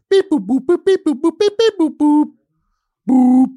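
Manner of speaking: high, loud beep-boop noises; robot beeps. Pretend. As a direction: chant beep boop like a computer. Computer noise picking guest.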